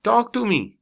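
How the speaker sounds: sharp, high and loud Talk to me; stern tone. Shown as a demonstration. Teacher showing students a stern, harsh tone. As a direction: bark it as a firm command. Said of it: "ये सख्त लहजा है".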